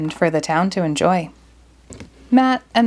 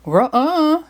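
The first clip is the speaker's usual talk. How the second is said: Scooby refusing; 7 semitones higher than usual. Acted. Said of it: "Scooby shook his head".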